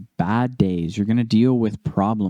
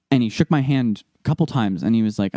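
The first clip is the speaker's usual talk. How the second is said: ordinary talk, nothing marked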